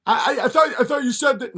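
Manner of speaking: high, loud excited Crowder voice; hammed-up high Crowder; eager Crowder. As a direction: gush like a thrilled Crowder. Crowder stammers.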